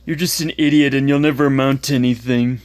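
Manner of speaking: taunting schoolyard bully voice; mocking bully. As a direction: jeer like an online troll. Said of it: "the ones that I, I quoted".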